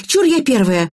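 put-on high-pitched eager child; yelling girl. A narrator's reading. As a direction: claim first place in a mock yell. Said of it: "завопила девочка". (высокий азартный детский возглас)

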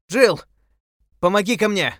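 high, loud cry for help; desperate shout. A narrator's reading. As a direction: shout it over the roar of water. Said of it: "вскричал он". (отчаянный крик)

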